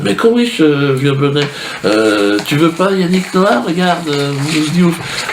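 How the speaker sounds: high, hammed-up wheedling fairy; mock fairy voice. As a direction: pitch it high like a pushy catalogue seller. Fairy pushes other celebrities.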